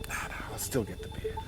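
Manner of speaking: soft, whispered refusal to himself; quiet inner defiance; stubborn whisper. Real, not acted. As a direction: argue back under your breath.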